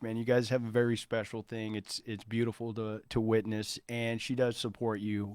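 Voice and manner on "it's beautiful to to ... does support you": ordinary delivery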